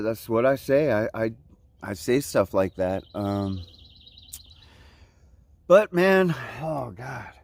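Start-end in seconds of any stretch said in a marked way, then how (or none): 5.7-7.3 awed sigh. Real, not acted. Sighing at the view.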